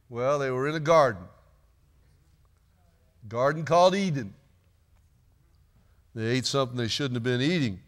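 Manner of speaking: ordinary talk, nothing marked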